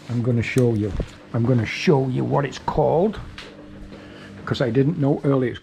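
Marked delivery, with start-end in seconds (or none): none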